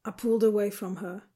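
plain reading voice

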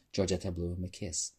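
ordinary delivery, nothing marked

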